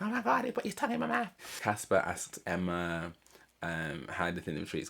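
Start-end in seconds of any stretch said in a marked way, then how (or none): 0.0-1.3 very high, fast mock squeal; giddy squeal. Vlogger imitating Amy gushing about the French kiss, high fast squeal. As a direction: gush like a scandalised girl, pitched way up. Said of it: "And she's like".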